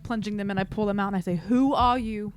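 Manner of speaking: ordinary talk, nothing marked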